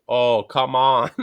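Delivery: slow, loud, stretched-out words; drawn-out, lagging exclamation; delayed speech. Acted. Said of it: "just delayed".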